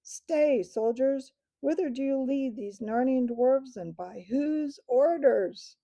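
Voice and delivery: thundering command. Acted. King stopping the soldiers on the road, thundering. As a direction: bellow it at the soldiers, slowing at the end. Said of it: "thundered Tyrion".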